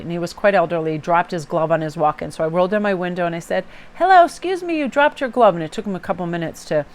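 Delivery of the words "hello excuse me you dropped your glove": high, raised call to a stranger. Retold or imitated. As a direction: call out politely from a car window. Calling out car window to stranger.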